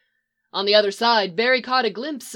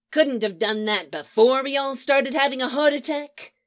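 a touch of irritated snap, slightly higher; mild snap; 4 semitones higher than usual. Acted. Leonard snapping at the magician who delayed, mild irritated snap. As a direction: snap at the sheepish magician, understated. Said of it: "he snapped".

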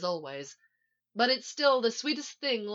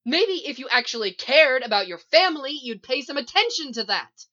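loud, high, angry accusation; heated, emphatic reproach; shouting; 4 semitones higher, 8 dB louder than usual. A narrator's reading. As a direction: lash out at them in a raised voice. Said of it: "Iris shouts".

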